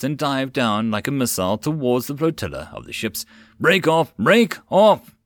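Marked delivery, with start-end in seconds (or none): 3.6-5.1 loud, higher urgent shout, building; urgent yell. A narrator's reading. Rider yelling over the radio, urgent shout. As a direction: scream the order into the radio. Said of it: "Stamford yelled urgently".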